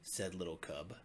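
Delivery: plain reading voice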